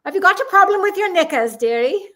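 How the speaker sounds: put-on high, quick British accent; loud, nosy British busybody lilt. British guy from next cubicle teasing her, very British accent. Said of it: "in a very British accent".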